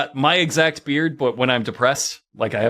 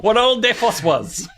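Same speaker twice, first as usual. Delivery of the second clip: high, hammed-up hillbilly twang; bad hillbilly accent; 8 semitones higher than usual. Shown as a demonstration. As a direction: finish in an overblown hillbilly accent. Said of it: "this would be the accent".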